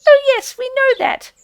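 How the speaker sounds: high, squeaky, sheepish mouse voice; shamefaced field mouse. A narrator's reading. Field mouse excusing itself to Rat, shamefaced and squeaky. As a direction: own up sheepishly in a tiny high voice. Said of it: "explained a field mouse rather shamefacedly".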